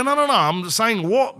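skeptical protest. Retold or imitated. Insisting he can hear.

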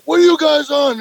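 high, loud accusing cop; hammed-up cop demand, pitched high; angry cop yell. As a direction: bark the question like an angry little cop. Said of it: "he's like".

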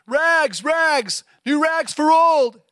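high, loud, slow peddler's call; street cry. Acted. Sobbing ragman still calling his wares, loud cry. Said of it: "calling in a clear tenor voice".

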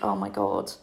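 very low, sinking "oh my God"; low muted dread, retold; dreading inner thought. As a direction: drop right down, as if thinking it privately. Inner dread in changing room.

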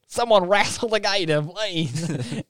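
high-pitched pretend panic; playful high call for rescue; mock plea for help. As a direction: beg up high, hammed up. Joking as panicked crocodile bystander.

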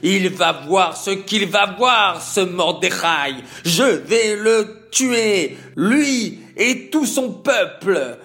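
high, loud raging threats; furious rant. Acted. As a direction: rage building into murderous threats. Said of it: "fou de colère". (fureur menaçante, aiguë et forte)

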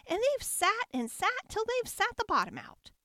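tiny, very high squeak; shrill baby bear. A narrator's reading. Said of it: "squeaked the baby bear in his shrill little voice".

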